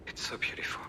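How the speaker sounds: awed whisper. Real, not acted. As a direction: breathe it out in wonder. Moved by the view.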